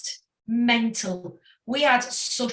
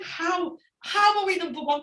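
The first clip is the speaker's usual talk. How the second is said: higher, amazed question; stunned disbelief; 7 semitones higher than usual. Retold or imitated. Singer quoting the band's reaction to their first number one, disbelieving. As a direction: ask it as if you can't believe it.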